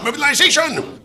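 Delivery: rallying cry to the engines; shouted command. Acted. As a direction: bellow the word mobilization. Officer rallies engines.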